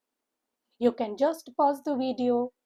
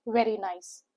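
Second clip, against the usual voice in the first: normal talking voice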